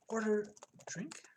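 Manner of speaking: whispered, slow command. Real, not acted. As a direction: breathe the words. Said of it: "typing command softly".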